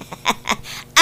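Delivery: a soft rueful chuckle; soft laugh. Acted. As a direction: laugh quietly at your own troubles.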